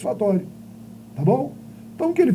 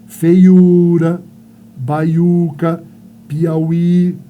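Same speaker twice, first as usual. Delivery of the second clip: loud, drawn-out U in "Feiura" and "baiuca", I in "Piauí"; vogais tônicas destacadas; 8 dB louder, much slower than usual. Shown as a demonstration. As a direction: punch and hold the stressed vowels of "Feiura, baiuca, Piauí". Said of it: "Vogais tônicas? U, I". ("Feiura, baiuca, Piauí" com U e I tônicos fortes e alongados)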